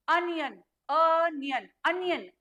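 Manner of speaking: अनियन repeated, bringing out the opening "uh"; sound demonstration. Shown as a demonstration. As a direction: model अनियन slowly for learners, isolating its first "uh". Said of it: "अ वाला sound आ रहा है". (अनियन में "अ" की आवाज़ उभारकर)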